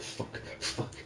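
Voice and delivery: breathless whispered curses under fire; panicked whisper. Retold or imitated. Gamer recalling a tense firefight, panicked whisper. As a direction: whisper it like you're pinned down and getting shot. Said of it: "'cause it was like".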